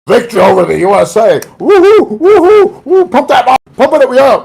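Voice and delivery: loud, high, fast cheering and whooping; mock victory shouting. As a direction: whoop it up like a crowd celebrating. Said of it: "and they're shouting".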